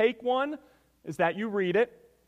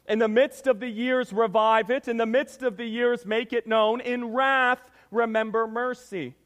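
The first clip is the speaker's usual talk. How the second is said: raised, loud, declamatory Bible reading; scripture reading; 4 semitones higher, 4 dB louder than usual. Acted. Preacher reads Habakkuk's prayer to the church, forceful scripture reading. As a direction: proclaim the prophet's prayer with force.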